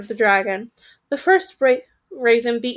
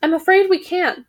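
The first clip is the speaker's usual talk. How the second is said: regretful raven; 6 semitones higher, 4 dB louder than usual. Acted.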